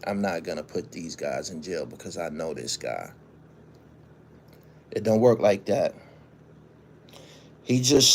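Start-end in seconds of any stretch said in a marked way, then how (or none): 0.0-3.1 lower, softer imitation of her private thought; prosecutor talking to herself. Imagined prosecutor privately declining the case, low self-talk. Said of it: "says to herself".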